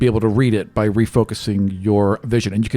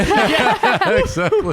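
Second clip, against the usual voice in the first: laughing; much slower than usual. Real, not acted. Laughing agreement.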